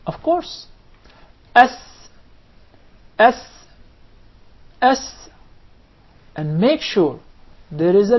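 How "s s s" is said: crisp whistled S on sin; isolated S with a clear whistle; whistling sin. Shown as a demonstration. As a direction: hold the S so it whistles. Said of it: "the whistle while pronouncing the letter sin".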